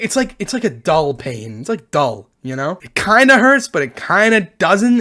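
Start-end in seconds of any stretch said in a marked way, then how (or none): none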